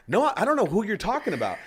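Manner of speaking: high-pitched protest of innocence; mock indignant denial. Acts out denying her accusation.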